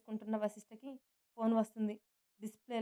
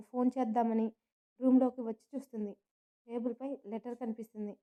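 plain reading voice

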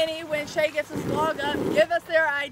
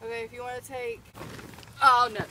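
ordinary delivery

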